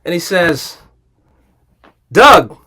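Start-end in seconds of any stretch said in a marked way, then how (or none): 2.1-2.6 louder, mildly excited greeting. Retold or imitated. Golf pro finally greets Doug, imitated excitement. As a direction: imitate an eager hello. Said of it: "like, excited".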